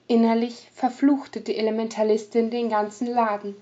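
ordinary delivery

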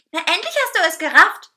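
high, loud cake voice scolding. A narrator's reading. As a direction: snap at them, loud and high-pitched. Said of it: "schimpfte dieser".

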